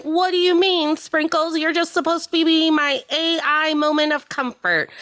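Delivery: mock betrayal at the dog; outraged Karen. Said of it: "they're like".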